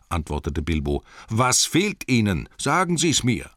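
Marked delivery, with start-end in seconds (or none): 1.3-3.5 higher, slightly stretched demand; insistent question. A narrator's reading. Bilbo asks Gollum what he has lost, insistent demand. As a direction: press firmly for an answer.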